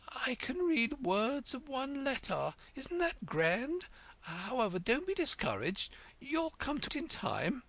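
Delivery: put-on a dithery old queen sharing a secret with Alice, lightly breathless.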